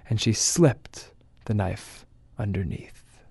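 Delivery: slow, soft, ominous ending; ominous hush. A narrator's reading. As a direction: let the last line creep out quietly.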